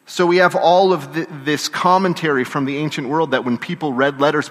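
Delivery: ordinary talk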